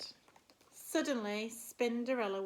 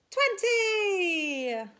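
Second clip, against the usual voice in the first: "twenty" shouted, louder and higher, long falling; 8 semitones higher, 11 dB louder than usual. Acted. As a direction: yell "twenty" like the big finish of the count. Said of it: "shout out a number".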